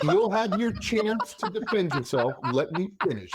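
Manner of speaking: high, mock-indignant protest. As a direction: hold the floor with playful indignation. Arguing over interruption.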